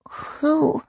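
put-on high child's mumble; mumbled around thumb. A narrator's reading. Granddaughter asks about the ring, high thumb-sucking mumble. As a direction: ask it with a thumb in the mouth. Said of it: "popped her thumb in her mouth and mumbled".

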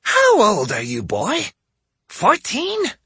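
put-on old woman voice. A narrator's reading. Old lady voice for Hansho.

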